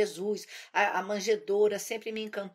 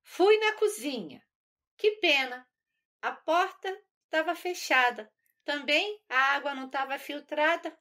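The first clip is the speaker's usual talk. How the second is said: put-on higher childish chant in steady metre; little girl singsong; 5 semitones higher, 3 dB louder than usual. Little girl tells mother about the locked kitchen, high singsong. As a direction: recite it like a small child's rhyme. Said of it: "é, fiz assim".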